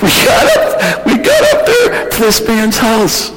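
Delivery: very high, squeaky laughing speech; laughing while talking. Real, not acted. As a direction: struggle to speak through real laughter. Laughing over the mule story.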